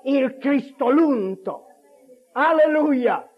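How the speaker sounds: high, loud, slow-rolling proclamation; full-force preaching. Real, not acted. Preacher exalting the one who raises the dead, full-force and exultant. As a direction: build to a shouted climax, words drawn out.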